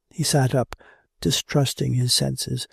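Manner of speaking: usual speaking voice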